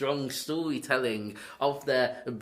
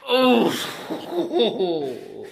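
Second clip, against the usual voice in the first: loud, high scandalised "oh" breaking into laughter; real laughing shock; shocked outburst; 9 semitones higher, 8 dB louder than usual. Real, not acted. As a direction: react aghast and amused. Shocked laugh at an edgy joke.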